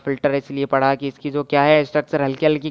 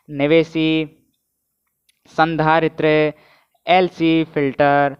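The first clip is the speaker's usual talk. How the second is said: slow dictation; much slower than usual. Shown as a demonstration. Teacher dictating the term slowly.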